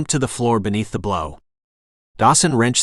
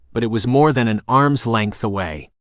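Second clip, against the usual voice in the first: ordinary delivery, nothing marked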